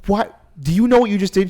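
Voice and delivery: aghast at what just happened; shocked disbelief. Retold or imitated. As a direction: Shion appalled after the shooting. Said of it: "Shion goes".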